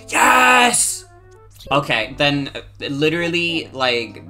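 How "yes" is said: excited. Real, not acted. Triumphant reaction.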